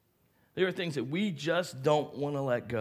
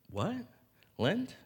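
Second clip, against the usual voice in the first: high, halting confused questions; clueless, questioning upward lilt; acted bafflement; 6 semitones higher, 5 dB quieter than usual. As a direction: puzzle it out in bewildered fragments. Himself as clueless teen, baffled.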